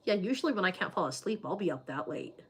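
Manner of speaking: ordinary delivery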